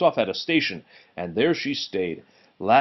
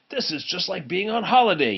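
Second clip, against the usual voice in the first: puffing engine; 7 semitones higher than usual. Acted. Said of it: "he puffed".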